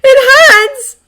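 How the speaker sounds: high, anguished scream; loud, tearful high-pitched outburst; crying out. A narrator's reading. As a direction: wail at full force in pain. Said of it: "she's crying out in his arms".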